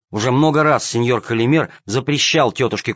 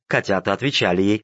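ordinary delivery, nothing marked

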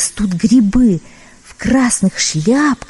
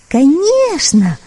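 higher, slow meowing kitten; 6 semitones higher, much slower than usual. Acted. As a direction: draw it out like a mewing kitten. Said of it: "мяукнул котёнок".

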